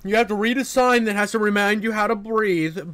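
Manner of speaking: usual speaking voice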